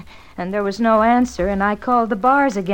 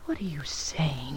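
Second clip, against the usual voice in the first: slow, breathless whisper of disbelief; shocked whisper; 8 semitones lower, 13 dB quieter than usual. Acted. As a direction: whisper it, stunned by the hint. Shocked wife hears suspicion.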